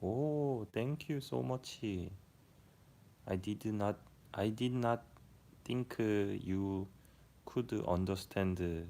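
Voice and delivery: slow, deliberate Korean-accented English. Shown as a demonstration. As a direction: speak careful English with a strong Korean accent. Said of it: "And Korean speak like".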